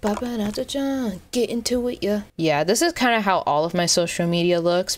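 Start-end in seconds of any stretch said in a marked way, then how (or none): 0.0-2.2 sing-song hype. Real, not acted.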